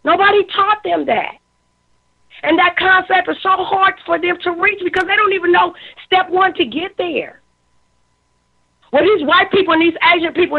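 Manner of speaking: choked up. Real, not acted. Moved to emotion speaking about her people, high strained plea. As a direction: voice rising as feelings take over. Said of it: "now I'm emotional".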